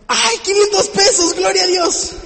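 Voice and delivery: high, loud, slow joyful cry at finding money; thrilled gasp at a 500 peso note; excited find. Retold or imitated. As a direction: squeal over the found bill. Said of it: "Imagínate que vas por la calle".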